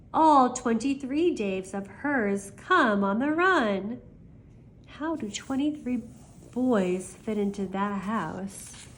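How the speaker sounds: plain reading voice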